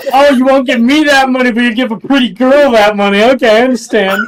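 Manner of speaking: teasing a friend as jealous Kane; mock jealous whine. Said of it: "You're like".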